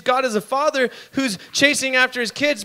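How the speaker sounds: ordinary talk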